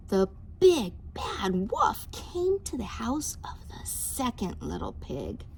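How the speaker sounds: ordinary delivery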